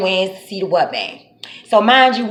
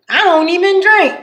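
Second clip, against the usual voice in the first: innocent denial; 9 semitones higher, 4 dB louder than usual. Retold or imitated. Drunk friend lies to officer.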